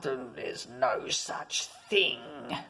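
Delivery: low, very slow gruff growl; captain growling with a smile. Acted. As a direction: growl it like a grumpy old sea dog. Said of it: "smiled and growled".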